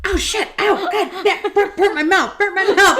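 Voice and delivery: high, loud comic yelping through laughter; hammed-up pain, cursing and laughing; retold scalded outburst. Woman re-enacting burning her mouth on tea, hammed-up yelping. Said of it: "in the other video I'm going".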